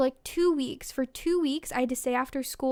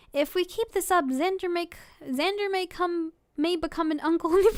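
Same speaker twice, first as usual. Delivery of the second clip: higher-pitched, about to crack up; trying not to laugh; 4 semitones higher than usual. Real, not acted. Reader continuing the fan fiction, trying not to laugh. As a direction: read it while losing the fight against laughter. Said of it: "without me trying to laugh".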